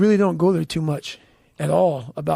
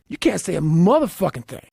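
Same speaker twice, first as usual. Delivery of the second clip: ordinary delivery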